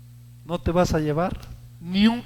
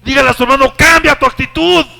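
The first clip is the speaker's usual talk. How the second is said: shouted much higher and louder; full-force preaching; 10 semitones higher, 15 dB louder than usual. Real, not acted. Preacher shouting to the congregation at full force. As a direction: call it out to the whole church.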